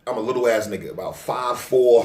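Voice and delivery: usual speaking voice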